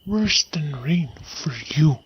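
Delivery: soft, whispered threat; hushed assassin's menace; menacing whisper. Acted. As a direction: whisper it like a lurking killer. Assassin threatens Banquo before ambush.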